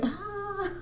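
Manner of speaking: thin, high-pitched sustained "aah"; high held "ah". Acted. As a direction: let out a long, high "ah". Acts out own reaction, wordless.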